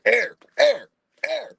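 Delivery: high panicked calling; mock yell of a scared dad, high; yelling a name.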